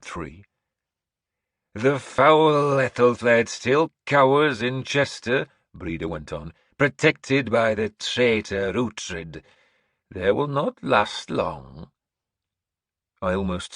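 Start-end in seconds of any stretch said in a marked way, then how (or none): 1.7-5.5 slow, high, loud proclamation; old seeress announcing. Acted. Seeress announcing to the hall, high and slow. Breda speaks. 6.8-11.9 put-on old seeress announcing. Breda speaks.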